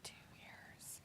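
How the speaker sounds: barely audible whispered repeat; hushed whisper. Pretend. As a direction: whisper it under the other person's talk. Teasing repeat under the other's talk.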